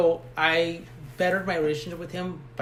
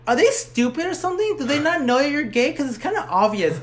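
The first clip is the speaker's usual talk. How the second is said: higher, louder retold disbelief; incredulous friend; 8 semitones higher, 4 dB louder than usual. Friend asking if the family is stupid, incredulous. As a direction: imitate a friend's incredulous questioning.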